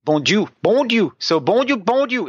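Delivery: Bon Dieu said as bon dju, pitched high; dialect bon Dieu. Shown as a demonstration. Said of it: "We change the sound".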